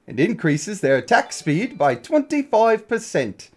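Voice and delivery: put-on British accent. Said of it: "I was reading it British".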